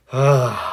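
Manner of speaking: a long, slow out-breath to let go; deep relaxing sigh. Shown as a demonstration. Coach shows a deep breath to a tense viewer, slow sigh. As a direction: exhale slowly and let the body relax. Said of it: "take another deep breath".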